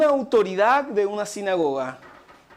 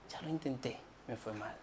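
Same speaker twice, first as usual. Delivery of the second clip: near-whispered defeat; 8 semitones lower, 20 dB quieter, faster than usual. Retold or imitated. Repeating the discouraged words.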